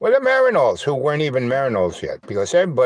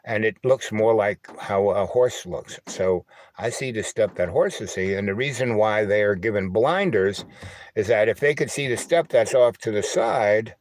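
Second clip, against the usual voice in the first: ordinary talk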